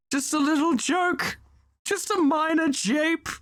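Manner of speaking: high, light and sly joking tone; playful weaselly voice. Acted. The defendant brushing off his prank to the lawyers, high and sly. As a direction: squeak it out, brushing it off.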